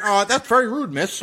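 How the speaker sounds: lightly voiced meek nerd; a hint of a timid, prim objector. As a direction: mildly imitate a nerdy bystander.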